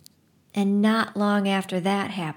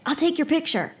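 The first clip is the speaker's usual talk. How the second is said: shouting; 8 semitones higher, faster than usual. A narrator's reading. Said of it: "shout to Nick".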